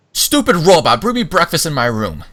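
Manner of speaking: loud, high bossy yell; yelling over shoulder. Acted. Sister yells at the robot over her shoulder while storming off, loud and bossy. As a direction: yell it back while marching off. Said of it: "She yells over her shoulder".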